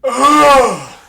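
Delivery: loud high-pitched groan of disgust. Real, not acted.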